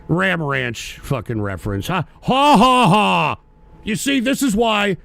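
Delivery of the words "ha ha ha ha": loud sarcastic ha-ha; sarcastic mock laugh. Real, not acted. Streamer scoffing at a troll's reference, sarcastic loud laugh. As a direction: laugh it off with scorn.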